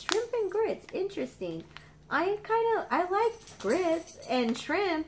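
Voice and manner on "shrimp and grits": pitched up, delighted; excited repeat. Real, not acted. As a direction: say "Shrimp and grits" again, high and pleased.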